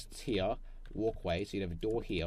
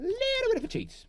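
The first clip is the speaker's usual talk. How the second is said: much higher little cheeky voice; squeaky voice; 6 dB louder, much faster than usual. Pretend. Builder cheats, playfully squeaky. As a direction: say it like a sneaky imp.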